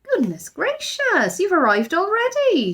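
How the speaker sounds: shocked, fussing housekeeper voice; Mrs Bird in horror. A narrator's reading. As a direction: gasp it out like a startled old housekeeper. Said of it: "she said in horror".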